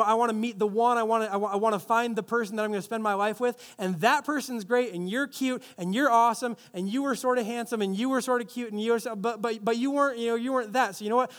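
ordinary talk

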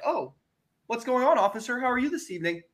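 butter up the cop; mock innocent politeness. Greeting the officer innocently.

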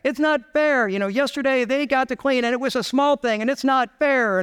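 high-pitched imitation of a child's protest; mock whine of a kid stuck with chores; complaining kid. Kids arguing over whose turn at dishes, imitated complaint. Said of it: "and they would argue".

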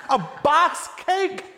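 very high, loud incredulous squeal; laughing disbelief in a high old lady voice; shocked disbelief. Retold or imitated. Grandmother shocked to learn it was a box cake, high squeal.

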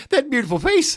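high, swooning mock praise; mock admiring. Podcast host gushing over a wrestler's beautiful face, mock swoon. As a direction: gush over his pretty face in a high voice.

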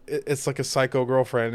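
usual speaking voice

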